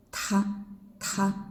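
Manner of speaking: "Ta" pushed out with a puff of breath; aspirated ta. Shown as a demonstration. Teacher showing aspirated Ta. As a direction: push air from the belly on Ta. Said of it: "and this one with breath".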